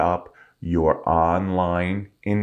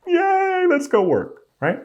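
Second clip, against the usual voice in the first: sarcastic yay; slower than usual. Acted. Sarcastic cheer about weak numbers.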